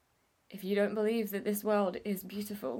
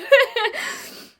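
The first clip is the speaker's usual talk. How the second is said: a loud, high burst of laughter; high embarrassed laugh; 12 dB louder than usual. Real, not acted. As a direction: laugh it off at yourself. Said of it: "a little bitch".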